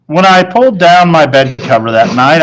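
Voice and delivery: plain reading voice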